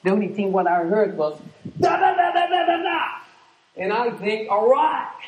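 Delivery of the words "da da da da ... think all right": mocking babble. Mocking what she says.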